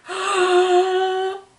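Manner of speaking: big storybook gasp; amazed gasp. Acted. Narrator reveals the monkeys in the tree, amazed gasp. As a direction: suck in a long, astonished breath.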